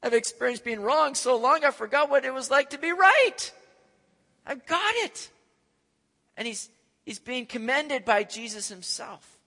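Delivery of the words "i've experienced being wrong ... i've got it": high, loud gloating joy; overjoyed, much higher and louder; elated Peter. Retold or imitated. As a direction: crow over getting it right. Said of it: "doing cartwheels all through Caesarea Philippi".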